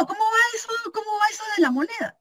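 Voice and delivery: a high, whiny imitation of mom, the second line fast; imitating mom. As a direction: mimic mom's eager, nagging question. Mother voiced in a story. (imitando a la mamá con voz aguda)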